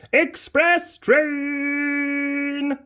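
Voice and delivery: very slow drawn-out high call; long stretched announcement; train call. Acted.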